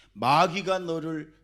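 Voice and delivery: loud slow preacher's cry; preaching shout. Real, not acted. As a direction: drag it out with full pulpit force. Preacher driving the point.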